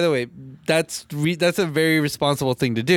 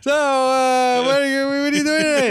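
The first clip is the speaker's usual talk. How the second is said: drown out the game with small talk; 11 semitones higher than usual. Retold or imitated. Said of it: "I just hear Edgar".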